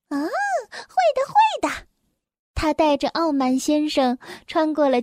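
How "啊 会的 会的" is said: very high little elf agreeing; grinning elf. A narrator's reading. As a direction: agree in a tiny, high voice with a grin. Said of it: "咧开嘴笑了笑".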